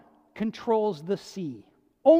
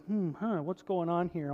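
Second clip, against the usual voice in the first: lightly voiced, softer, just-woken mumble; a hint of someone shaken awake; groggy Jesus; 5 semitones lower, 5 dB quieter than usual. Jesus woken mid-storm, lightly groggy mumble. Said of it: "Jesus kind of sort of groggy".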